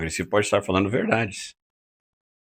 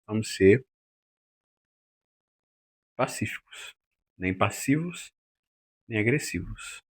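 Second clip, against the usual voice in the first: soft appeal; 7 dB quieter than usual. Shown as a demonstration. Teacher urges peaceful speech.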